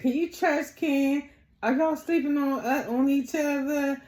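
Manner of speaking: high-pitched nosy questioning; mimicked prying. Acting out T's prying questions.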